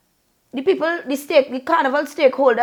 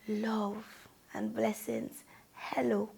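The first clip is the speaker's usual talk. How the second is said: much softer, tender send-off; soft blessing; 3 semitones lower, 18 dB quieter, slower than usual. Real, not acted. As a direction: murmur it like a blessing.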